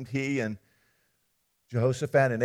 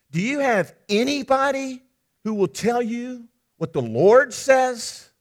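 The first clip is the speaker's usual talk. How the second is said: scoffing disbelief; 8 semitones higher, 6 dB louder than usual. Acted. Jehoshaphat scoffs at the paid prophets.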